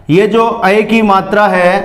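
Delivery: ordinary delivery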